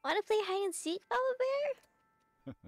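put-on high, sing-song little kid; high child voice. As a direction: coax papa like a small child. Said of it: "game voice acted by [name] and [name]".

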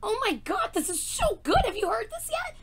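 high, giddy gush; excited gushing. Real, not acted. Fan asking babe about the song, excited gushing. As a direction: gush up high to someone across the house.

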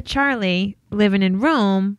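normal talking voice

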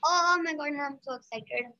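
ordinary talk, nothing marked

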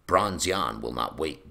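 ordinary delivery

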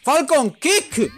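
high, loud, drawn-out move call; attack shout. Acted. Gamer shouting Falcon Kick as he lands it. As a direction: stretch the move name like an anime yell.

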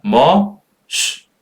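"머" held strong, then "시" as a voiceless sh; syllable-by-syllable model. Shown as a demonstration. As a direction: split the word into "머" and a hushing "시". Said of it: "따라 읽어볼게요". ("머"와 "시"를 나눠서 발음)

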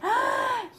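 sharp high gasp of wonder; delighted gasp. Real, not acted.

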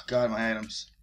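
ordinary talk

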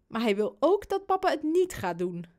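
ordinary delivery

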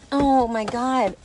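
ordinary talk, nothing marked

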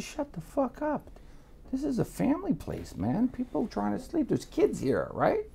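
high, hushed telling-off; high-pitched exasperated plea to quiet down; retold scolding. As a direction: scold a noisy friend in a high, softened voice. Retold scolding of noisy friend.

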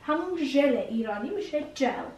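ordinary delivery

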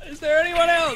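high, loud battle yell; excited shout. Real, not acted. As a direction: yell it mid-fight.

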